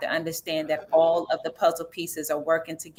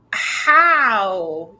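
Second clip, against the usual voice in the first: incredulous how; 2 semitones higher, 8 dB louder than usual. Retold or imitated. Her own disbelief at mother's faith.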